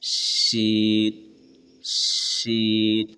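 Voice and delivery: شین with a long, slow hushing ش; a sustained sh in شین; sh sound drawn out. Shown as a demonstration. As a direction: stretch شین slowly from mid-tongue. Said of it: "زبان کے بیچ سے آواز نکالتے ہوئے شین".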